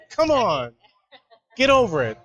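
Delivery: high, falling retold plea of exasperation; exasperated. Coach retelling reaction to whining player, high exasperated. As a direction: act out being fed up, pitch swooping down.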